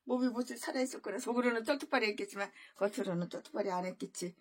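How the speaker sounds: ordinary talk, nothing marked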